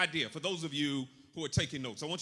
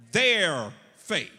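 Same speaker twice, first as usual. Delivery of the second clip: "Their" drawn out loud, then "faith"; stress on THEIR; 12 dB louder than usual. Shown as a demonstration. Preacher answering his emphasis question, heavy stress on "Their". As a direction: lean hard and long on "Their". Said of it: "The emphasis is on what?".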